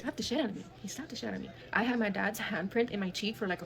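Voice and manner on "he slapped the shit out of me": soft, nearly whispered disbelief; hushed repetition. Real, not acted. Daughter tells how dad hit her, hushed. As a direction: drop to a breath for the repeat. Repeats the slap for effect.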